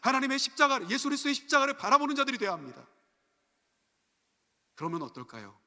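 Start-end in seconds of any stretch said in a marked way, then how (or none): 0.0-2.9 loud, high-pitched sermon proclamation; impassioned preaching. Real, not acted. Preacher urging the congregation to look to the cross, impassioned. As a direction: drive it home from the pulpit at full force.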